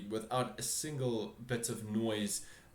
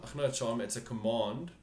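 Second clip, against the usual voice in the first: ordinary talk, nothing marked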